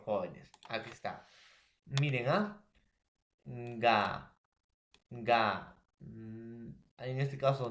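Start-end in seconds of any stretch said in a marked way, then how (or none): 3.4-5.8 "Ga" stretched alone, then repeated as "ga"; isolated syllable. Shown as a demonstration. Teacher explaining syllables to students, slow isolated "ga". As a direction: hold each "ga" by itself, with a pause between. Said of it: "acá explicamos mejor".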